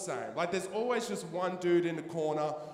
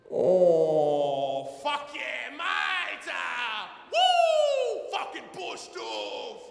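much higher, shouted wild whoops; ecstatic rush; 10 semitones higher, 6 dB louder, much slower than usual. Retold or imitated. Bloke high on meth hollers to Davo, ecstatic shouting. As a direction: scream with drug-fuelled euphoria.

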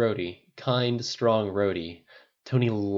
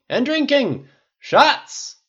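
put-on high, loud drunken rallying call; rowdy shout; 11 dB louder, much slower than usual. A narrator's reading. Tony rallying his friends to drink, rowdy shout.

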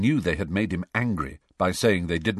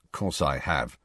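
grumpy; 4 semitones lower, 4 dB quieter than usual. A narrator's reading. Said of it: "said Harry grumpily".

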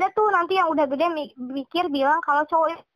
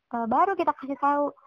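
normal talking voice

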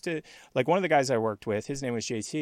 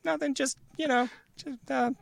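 very high, hesitant fumbling; mock-innocent excuse. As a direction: play it innocent in a high little voice. Innocent excuse to angry coworker.

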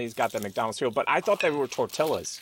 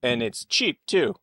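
usual speaking voice

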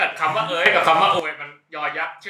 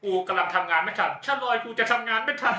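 mock old Thai example; 6 semitones higher, 4 dB quieter than usual. Shown as a demonstration. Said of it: "เช่น".

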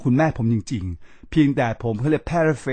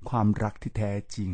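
soft echo; 5 semitones lower, 8 dB quieter than usual. Shown as a demonstration. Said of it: "repeat of mother's words".

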